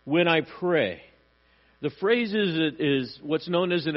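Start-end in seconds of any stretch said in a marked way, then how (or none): 0.1-1.0 raised, pleading call to God; crying out. Retold or imitated. Preacher voices David asking God to hear his prayer, crying out. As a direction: plead upward for God's attention. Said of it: "Crying out".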